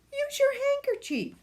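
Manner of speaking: high exasperated grandma; chiding. A narrator's reading. Grandma telling sick son to blow his nose, high chiding. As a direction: nag him, pitching up.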